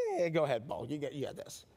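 casual dismissive tone; dismissive brush-off. Retold or imitated. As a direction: wave him off lightly. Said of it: "God's just dismissing".

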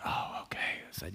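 hushed, near-whispered surrender; soft acceptance. Retold or imitated. Preacher recalling his answer to God's call, soft acceptance. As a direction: give in softly, almost under the breath.

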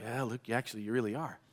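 soft, low imitation of his wife; hushed, reluctant quoted reply; reluctant confirmation. Wife gently confirms his anger.

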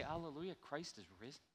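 hushed uncertain question; doubting question. Shown as a demonstration. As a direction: ask it quietly in disbelief. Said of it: "How can that be?".

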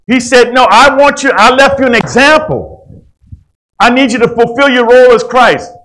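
loud, high, driving preacher's cry; fervent pulpit preaching, loud and high; preaching at full force. Acted.

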